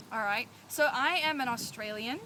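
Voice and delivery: usual speaking voice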